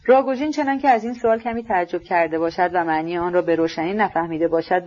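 ordinary delivery

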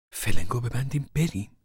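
questioning disbelief. Acted. Mother questions Tom's plan to flee, taken aback. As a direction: echo it back, taken aback.

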